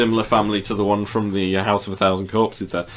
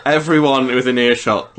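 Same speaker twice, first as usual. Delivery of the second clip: ordinary talk, nothing marked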